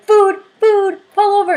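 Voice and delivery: high, loud, thrilled calling out; excited shout. Retold or imitated. Thrilled passenger shouting at the driver to stop for food. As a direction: yell at her driver to pull over for food.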